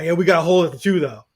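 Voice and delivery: loud mock fan whine; mock crying complaint. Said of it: "and then people cry".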